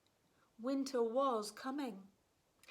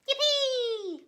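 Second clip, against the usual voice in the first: high and loud cheer; gleeful yippee; 11 dB louder than usual. Acted. Little goose thrilled to go flying, high loud yippee. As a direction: squeal it with delight. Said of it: "Gooseberry replied".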